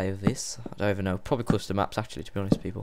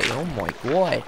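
ordinary talk, nothing marked

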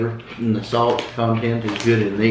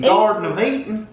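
high-pitched country accent, teasing imitation; imitated country accent; 9 semitones higher than usual. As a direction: mimic a country accent with big emphasis. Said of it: "your emphasis of your country accent".

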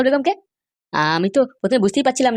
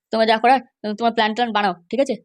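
ordinary talk, nothing marked

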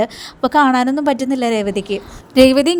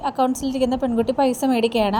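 ordinary delivery

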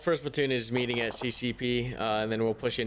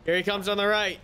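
shouting; 8 semitones higher, 4 dB quieter than usual. Real, not acted. Calling out in firefight.

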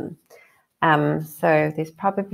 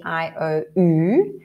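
ordinary delivery

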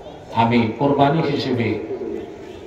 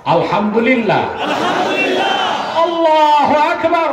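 building to a shout; 11 semitones higher, slower than usual. Real, not acted. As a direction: take a big breath and swell into a roar. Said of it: "বড় শ্বাস নিয়ে বলি".

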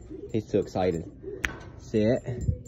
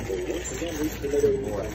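soft cooing; 2 semitones higher, 3 dB quieter, much slower than usual. Real, not acted. Cooing at dog, soft far voice.

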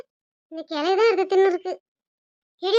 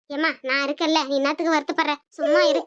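consoling son; 5 semitones lower than usual. Acted. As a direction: reassure your mother.

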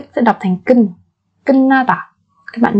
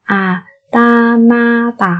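very slow syllable drill; much slower than usual. Shown as a demonstration. Teacher drills word very slowly.

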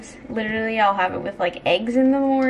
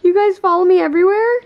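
high, loud delighted squeal; excited squeal; 9 semitones higher, 10 dB louder than usual. Real, not acted. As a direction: squeal it out with a laugh. Dogs following her, squealing.

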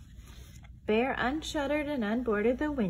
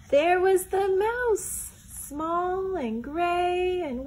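slow, high, sing-song reveal; stretched-out sing-song reveal; dramatic reveal; 8 semitones higher, 4 dB louder, much slower than usual. A narrator's reading. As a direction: draw it out like a storybook surprise.

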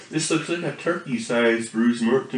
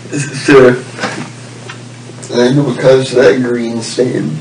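loud announcing; 3 semitones lower, 10 dB louder than usual. Pretend. Loud mock-serious address.

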